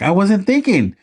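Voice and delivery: very high, squeaky imitation; hammed-up high-pitched reply; mock pilot punchline. Quoting punchline.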